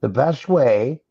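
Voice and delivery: normal talking voice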